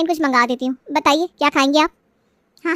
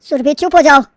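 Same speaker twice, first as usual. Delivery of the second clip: stern order; 2 semitones lower, 6 dB louder than usual. Acted. Husband silences his wife.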